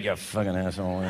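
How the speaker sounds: polite chat that drops low and soft into a muttered curse; chirpy host, then a dark low aside; muttered insult. Retold or imitated. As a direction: sneak the insult out under his breath. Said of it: "can't even say fuck".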